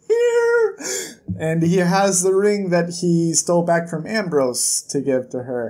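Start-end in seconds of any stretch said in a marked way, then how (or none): 0.1-0.8 mock tearful. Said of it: "And he says".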